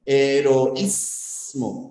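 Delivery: "heroísmo" very slowly, he-ro-ÍÍS-mo; stress on I. Shown as a demonstration. Teacher showing the hiatus to class, slow stressed Í in "heroísmo". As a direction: draw out "heroísmo", separating O from stressed I. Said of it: "Hiato acentual".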